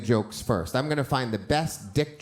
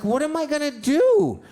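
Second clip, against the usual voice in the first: put-on much higher, louder pleading child; desperate kid; 13 semitones higher, 6 dB louder than usual. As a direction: beg like a lost little boy. Kid pleading to his aunt.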